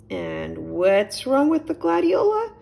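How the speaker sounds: a gentle host offering milk; a hint of a friendly little chipmunk; Nigel the chipmunk. A narrator's reading.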